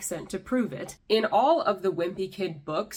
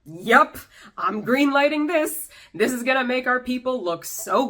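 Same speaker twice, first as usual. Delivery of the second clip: louder, higher, cheery mock approval; mock proud parents; 5 semitones higher, 6 dB louder than usual. Mocking actor's parents approving the accent, loud cheery voice. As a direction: play the clueless proud parents, beaming. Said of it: "were just like".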